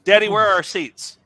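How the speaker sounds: high, loud call with a petulant edge; pretend yell over. Son asking dad about plane seats, petulant mock yell. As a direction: holler to Dad across the cabin. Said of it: "you have to kinda yell over".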